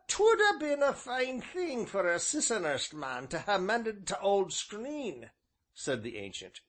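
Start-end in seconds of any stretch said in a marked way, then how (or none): 0.1-5.3 put-on high old countryman's voice; old rustic innkeeper. A narrator's reading. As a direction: voice the old villager, folksy and drawn. Ancient's dialect voice.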